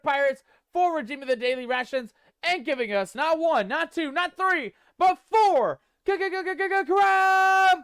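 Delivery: stammering build to a high, loud crab raves; announcer building hype to a shouted crab raves. Acted. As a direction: rev up the chat, stutter the c-, then blast it. Hyping crab rave announcement.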